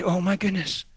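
very soft, low marveling; hushed, delighted awe; gentle wonder. Shown as a demonstration. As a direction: respond tenderly to someone crying. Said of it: "you look at them and you say".

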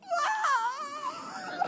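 high, thin cry of shock. Retold or imitated. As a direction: let out a stunned high wail. Coworker overwhelmed, cries out.

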